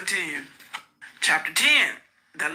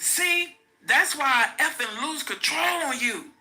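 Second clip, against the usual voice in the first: sudden high outburst at her; exploding in anger; 8 semitones higher than usual. A narrator's reading. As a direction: snaps into a furious, high-pitched blowup. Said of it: "Sean exploded".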